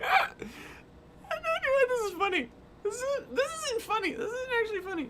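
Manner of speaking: high, breaking giggles through the words; can't stop laughing while talking; laugh-cry through the denial; laughing helplessly. Real, not acted. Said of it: "Why am I laughing?".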